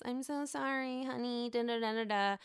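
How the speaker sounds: soft, sarcastic sorry honey; mock his limp apology, quiet; sarcastic impression of a sheepish husband. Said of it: "he apologizes".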